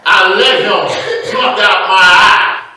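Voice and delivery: loud, slow, drawn-out confession; anguished outcry. Acted. Preacher repeating the bird's confession to the congregation, loud outcry. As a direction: cry it out slowly to the whole church.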